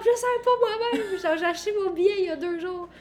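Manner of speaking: high giddy laughing voice; excited laughing. Retold or imitated. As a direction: bubble over with glee. Her own excited reply, quoted.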